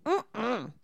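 put-on closed-mouth whine like a two-year-old; toddler refusal. Mimicking a sulky toddler to friends at the table. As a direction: hum a pouty no like a kid. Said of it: "kids where they go".

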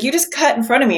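retold indignant "you cut in front of me"; irritated mock complaint. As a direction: huff it out like a man who's just been cut in line. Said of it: "he was like".

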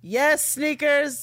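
high, slow, loud delighted squeal; excited squeal. Real, not acted. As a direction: stretch it out with delight. Hyped about custom sneakers.

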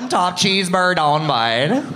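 drawn-out mock Ottawa local accent; broad East End Ottawa twang, stretched out. Comic mimics hometown guys chatting, Ottawa East End accent. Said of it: "The only accents that I was ever exposed to".

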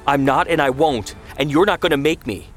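defiant refusal. A narrator's reading. Son refusing his mother's order, defiant. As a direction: refuse hotly, rushing the last words.

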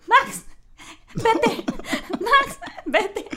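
high, loud laughing shoo; laughing panic. Retold or imitated. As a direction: shoo the dog away while laughing. Shooing the dog while peeing. (espantando al perro entre risas y pánico)

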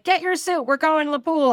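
high, loud mock call from the van; bossy friend yelling up to the apartment. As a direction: order her out like a pushy friend. Friend honking outside, calling out.

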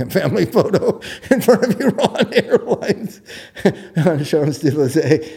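words broken up by real laughter; laughing. Real, not acted. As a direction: chuckle as you tell it. Laughing at the family photo.

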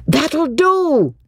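high firm shout of That'll do. A narrator's reading. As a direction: stop them with a call. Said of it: "shouted Mister Beebe".

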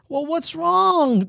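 high, slow acted question; concerned nurse. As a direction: voice a worried nurse, drawn out. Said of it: "the nurse says".

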